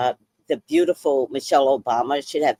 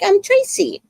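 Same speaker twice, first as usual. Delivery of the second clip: high, chirpy innocent self-introduction; sweet innocent me. Retold or imitated. Answering a coworker's question, high and chirpy. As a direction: play it sweet and clueless. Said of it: "And I'm like".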